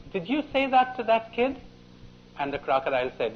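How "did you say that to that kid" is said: high, sharp rabbit voice; a quick little rabbit questioning. Retold or imitated. Rabbit questions the crocodile in a high voice. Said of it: "The rabbit ... says to the crocodile".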